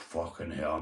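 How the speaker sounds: relieved mutter. Retold or imitated. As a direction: let the tension go under your breath. Relief after spider is gone.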